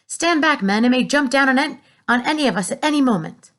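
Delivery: high, loud commanding warning; urgent warning. A narrator's reading. Police chief shouting to his men. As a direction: order the men back, alarmed.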